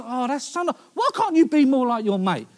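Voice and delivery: a hint of an impressed mother; mum's praise. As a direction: mum comparing you to your mate. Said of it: "your mum turns to you and goes".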